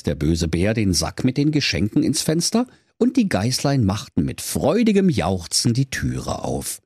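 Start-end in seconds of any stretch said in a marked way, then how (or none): none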